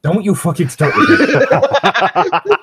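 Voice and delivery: slow laughing exasperation; exasperated. Real, not acted. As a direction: drag it out in fake outrage.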